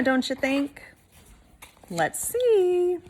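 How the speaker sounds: ordinary delivery, nothing marked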